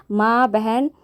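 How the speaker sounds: ordinary delivery, nothing marked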